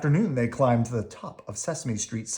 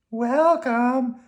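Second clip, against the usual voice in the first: high squeaky worm; high, cheerful little worm; 12 semitones higher, 6 dB louder than usual. Acted. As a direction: pitch it up like a tiny worm.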